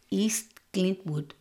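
Eastwood said as "East Clintwood", slow; slow "East Clintwood" in mom's voice; mom's mispronunciation. Retold or imitated. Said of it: "no matter how many times we corrected her".

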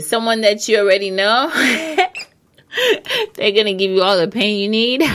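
talking through laughter; laughing. Real, not acted. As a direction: giggle through the words. Laughing at her own joke.

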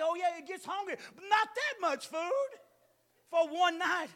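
shouted retort. Retold or imitated.

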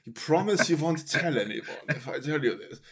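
lightly voiced secretive Hitler; confiding like a hammy dictator. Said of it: "he was like".

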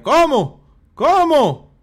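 escalating baffled shrieks; mock disbelief. Reacting to a listener's confusing comment, building mock disbelief.

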